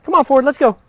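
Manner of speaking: loud, high "come on, Ford, let's go"; trainer's bright go command; firm upbeat command. Shown as a demonstration. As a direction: call the dog forward with energy. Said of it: "tell him".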